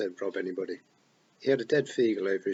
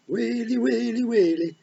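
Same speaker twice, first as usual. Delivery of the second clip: feagle babble; 6 dB louder, slower than usual. A narrator's reading. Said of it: "wasn't making any sense at all".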